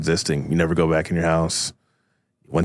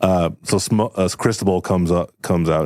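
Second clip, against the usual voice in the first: ordinary delivery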